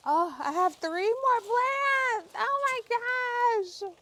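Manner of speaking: loud, very high, drawn-out wail; dismayed wail. Real, not acted. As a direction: wail slowly in overwhelmed dismay. Dismay at finding more plants.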